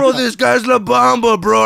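put-on slow loud starstruck drunk voice. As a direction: gush drunkenly about a star. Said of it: "my friend was like".

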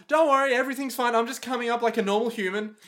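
high, fast, falsely reassuring; chirpy innocent act; mock cheerful. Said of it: "It's like".

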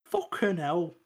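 high, soft mock Scouse; Scouse accent. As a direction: softly play at a Scouse accent. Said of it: "that's how to do a Scouse accent".

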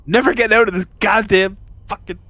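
loud exasperated yell; frustrated shout. Real, not acted. Failed save, frustrated outburst.

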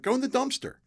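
high-pitched imitation of an older kid; dismissive order. Older kid to the one needing a bathroom, dismissive order.

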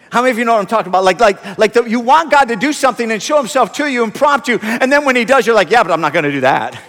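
loud, high, driving sermon delivery; full-force preaching. Real, not acted. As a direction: preach it loudly to the whole room.

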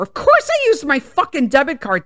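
ordinary talk, nothing marked

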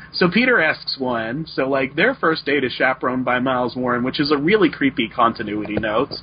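usual speaking voice

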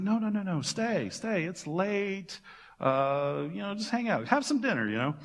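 pleading hosts. Acted. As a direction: beg him to stay for dinner, lightly acted. Disciples urging Jesus to stay.

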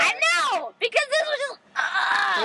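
loud high crying-out; excited outburst. Real, not acted. Outburst about kids.

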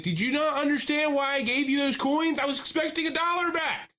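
high, loud furious customer rant; imitating a man yelling at the cashier, high and loud; angry yelling. Customer yelling at the fast food cashier, furious mock shout. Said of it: "yelling at these people".

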